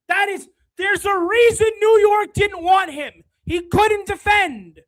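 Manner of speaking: shouted fan outrage; angry rant. Real, not acted. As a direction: yell it at the screen. Streamer ranting about player.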